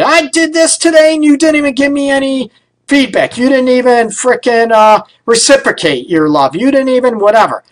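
lightly hammed-up nagging spouse; whiny partner complaint. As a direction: mimic a hurt partner listing grievances. Mocking a neglected partner's complaint.

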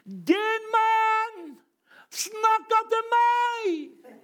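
high, slow excited cry of recognition; mock-thrilled yell, high and slowed; excited shout. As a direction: shout it out, pointing at the man. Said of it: "så roper han".

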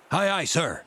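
high-pitched seaman's reply; pirates answering. A narrator's reading. Men answering the blind beggar's command, crisp high call. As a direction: call back an eager aye-aye.

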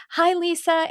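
high-pitched retold hello; acted greeting. Greeting someone she knows really well, high retold hello.

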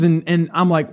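ordinary talk, nothing marked

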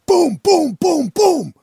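high-pitched booms in quick repeats; imitated banging. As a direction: punch out each boom like a hit on the van. Imitates repeated smashes on the van.

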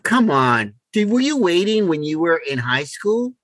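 usual speaking voice

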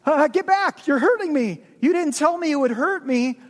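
a frightened ghost yelping in pain. Acted. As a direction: push him off, it hurts. Ghost hurt by angel.

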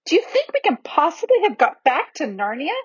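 put-on high, wondering little-girl voice; excited child's high-pitched question; exclaimed. A narrator's reading. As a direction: ask in amazed hope, pitched well up. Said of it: "exclaimed Lucy".

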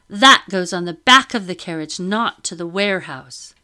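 loud, bossy orders; commanding lady. Acted. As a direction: command like the mistress. Madame orders deckhands.

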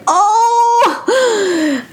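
high-pitched, drawn-out "ohh" squeal; excited outburst. Acted. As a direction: let out a long high squeal of anticipation. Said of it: "I'm just like".

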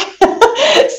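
high, short giggle. Real, not acted. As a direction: giggle at yourself. Host laughs about homework.

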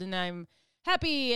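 usual speaking voice

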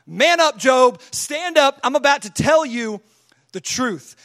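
punchy confrontational shout; God going hard. Acted. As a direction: call Job out at full force. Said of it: "he just goes hard on him".

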